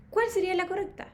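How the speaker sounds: ordinary delivery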